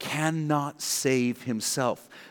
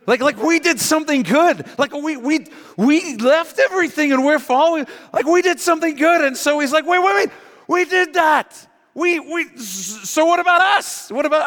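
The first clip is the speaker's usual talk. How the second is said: high, loud, excited squeal of realisation; genuinely excited Peter; 7 dB louder than usual. Retold or imitated. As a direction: Peter suddenly gets it and can't contain himself. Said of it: "I think he's genuinely excited".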